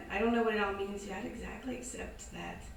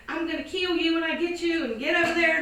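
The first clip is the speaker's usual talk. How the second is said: angry yell; 8 semitones higher, 12 dB louder than usual. Retold or imitated. Owner chasing her runaway dog.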